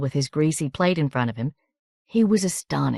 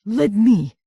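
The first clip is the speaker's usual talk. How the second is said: put-on blunt rough country accent; gruff country woman insisting; coarse country accent; 6 semitones higher, 5 dB louder than usual. A narrator's reading. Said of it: "in a coarse country accent".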